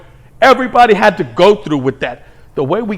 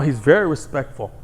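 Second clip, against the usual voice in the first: ordinary talk, nothing marked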